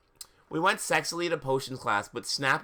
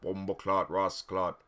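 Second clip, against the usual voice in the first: put-on Jamaican accent; 5 semitones lower than usual. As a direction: try out a Jamaican accent under your breath. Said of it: "he had a Jamaican accent".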